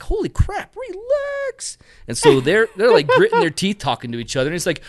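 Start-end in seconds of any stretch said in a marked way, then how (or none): 0.0-1.8 high exasperated plea. Retold or imitated. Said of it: "I'm like".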